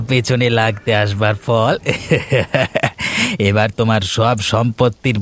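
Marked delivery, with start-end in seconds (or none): 1.8-3.4 gloating villain cackle; evil laugh. Acted. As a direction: cackle over the victim. Villain gloats over the drowning man.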